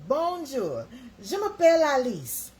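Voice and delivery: high, loud mock French introduction; schoolbook French. Shown as a demonstration. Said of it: "French was mine".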